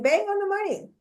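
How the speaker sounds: squeaky high mock chat voice; reading out chat. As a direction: read the comment in a silly high voice. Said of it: "somebody said in the chat room".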